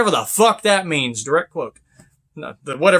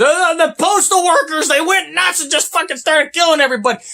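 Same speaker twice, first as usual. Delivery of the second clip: higher, voiced gossip mimicry; mocking the gossip; 9 semitones higher than usual. Mimicking what everybody said about postal workers: high gossipy mimic. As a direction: imitate the eighties chatter. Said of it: "where everybody was".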